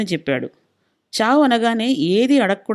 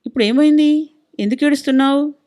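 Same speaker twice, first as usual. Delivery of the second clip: higher, louder startled questioning; 6 semitones higher, 5 dB louder than usual. A narrator's reading. Husband finding his wife crying in the kitchen, startled. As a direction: demand to know, taken aback. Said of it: "ఉలిక్కిపడ్డాడు".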